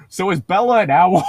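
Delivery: mock tweet question. A hint of a silly fan question to Stephanie Meyer. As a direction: ask it like a goofy fan tweet. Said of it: "tweet at Stephanie Meyer".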